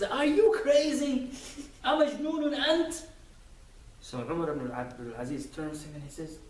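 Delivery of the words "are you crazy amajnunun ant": slow, loud yelled demand, much higher; startled angry shout. Retold or imitated. Sleeper tripped over in the dark shouts angrily. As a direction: yell it like a man just woken by a stumble. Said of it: "the man wakes up and he says".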